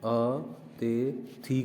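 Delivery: अतिथि drawn out slowly, syllable by syllable; wrong spelling said aloud. Shown as a demonstration. Hindi teacher to students, stretching अतिथि slowly. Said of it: "अब इसको वो क्या कर देगा".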